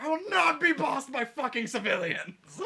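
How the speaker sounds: high, loud, slowed-down outburst; indignant acted scream. Ruler stopped by guards at the door, screaming indignantly. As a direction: scream it out slowly in hammed-up indignation.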